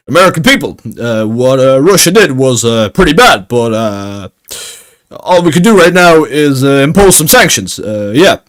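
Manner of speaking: halting politician's speech, pausing on every uh; Obama. Retold or imitated. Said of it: "He was like".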